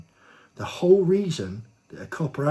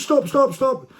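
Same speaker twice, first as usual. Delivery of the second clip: much higher, loud mock scream of "Stop"; retold screaming; 14 semitones higher, 8 dB louder, slower than usual. Policewoman screaming as he winds the window up, a loud mock scream. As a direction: shriek it like a panicking cop. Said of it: "And she goes".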